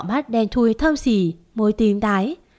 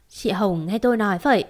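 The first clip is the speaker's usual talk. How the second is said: ordinary delivery, nothing marked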